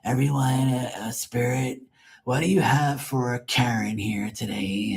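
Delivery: lightly voiced fake psychic; airy put-on psychic reading; mocking the rival medium. Mocking a rival medium's live reading, lightly voiced. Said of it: "go like this and say".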